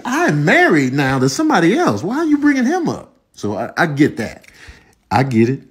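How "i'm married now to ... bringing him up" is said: high-pitched mock exasperation; protest, pitch jumping up on the question; exasperated comeback. Married person answering nosy family, exasperated. Acted annoyed reply to relatives.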